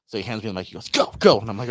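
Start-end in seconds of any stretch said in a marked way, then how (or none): 0.9-1.4 loud, higher retold command; urgent send-off. Man handing over the mic to his runner, urgent loud order. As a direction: send someone running, louder and higher.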